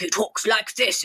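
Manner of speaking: slow, put-on storybook character voice; slow performed children's-book voice; Moonface. Shown as a demonstration. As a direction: draw it out in a specific character voice. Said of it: "has a very specific voice".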